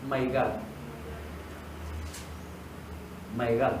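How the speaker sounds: "My God" in English, soft and low; two soft, low "My God"s. Shown as a demonstration. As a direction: quietly model "My God" in English. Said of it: "¿Cómo dicen mi Dios?".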